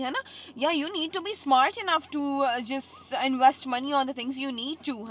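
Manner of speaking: loud and high, ranting; heated shouting. Real, not acted. Venting to viewers about rent, loud and heated. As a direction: rant about wasting money at full voice. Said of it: "ਮੈਂ ਕਿੰਨੀਆਂ ਚੀਕਾਂ ਮਾਰਦੀਆਂ, sorry about that".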